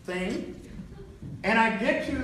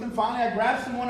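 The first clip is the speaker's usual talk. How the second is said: ordinary talk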